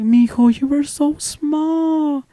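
high, slow, tender cooing; imitated fond teacher. Teacher remembering her little pupil, high and tender. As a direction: coo it sweetly, drawing out the end.

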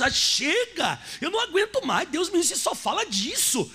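protesto exasperado, bem mais agudo; pessoa farta, imitada. Acted. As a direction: imitate someone who has had enough, pitched high. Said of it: "tem pessoas dizendo assim". (imitando alguém que não aguenta mais)

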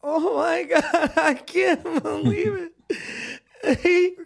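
high, tearful mock cry; imitating Kim crying over the gift; mock sobbing. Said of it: "She's like".

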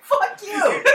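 high, loud, through laughter; laughing insult. Real, not acted. Gamer who lost a bet, high laughing jab. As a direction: curse your friend while cracking up.

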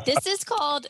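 high, loud nasal twang; playful nasal twang demo. Shown as a demonstration. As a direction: push it up through the nose, twangy. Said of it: "this is called a nasal twang".

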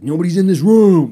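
high, loud outraged complaint; mock fury. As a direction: yell it like a fed-up dad. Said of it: "imagine the fury that you feel".

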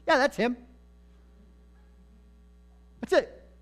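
eager Saul. Acted. Saul eagerly confirming to the witch.

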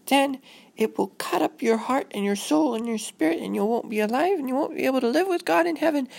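put-on high-pitched earnest child voice; high, solemn kid voice; anxious little girl. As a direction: play a worried girl lecturing her parents. Voices Robin explaining her fear.

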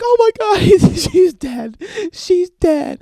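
high, loud, drawn-out wailing; mock hysterical wail. Girlfriend finds her bird dead, mock hysterical wailing.

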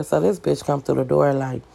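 usual speaking voice

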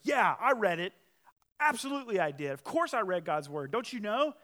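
loud, high indignant retort. Acted. Young man defending himself to his mom, indignant. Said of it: "he gets indignant".

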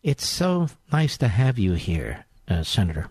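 lightly mock a deferential TV interview; fawning TV interviewer. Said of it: "sixty Minutes interview like".

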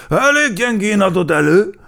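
high, loud critical cheetah voice; loudly pan the performance, pitched high; Gepárd Géza criticizing. A narrator's reading. Cheetah mocking the crow's singing, loudly critical. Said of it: "mondta neki Gepárd Géza".

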